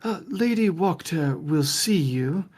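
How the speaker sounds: lightly voiced stiff butler; a formal servant, a touch put on. Old butler ushering the guest inside, lightly voiced. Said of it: "and he says".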